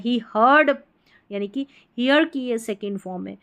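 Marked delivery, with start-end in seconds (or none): 0.4-0.8 heard as hurd, one syllable without a y-glide; correct pronunciation. Shown as a demonstration. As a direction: say heard with a central vowel, rhyming with bird. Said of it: "इसको हियर्ड नहीं पढ़ना है". (heard को सही ढंग से, हर्ड बोलें)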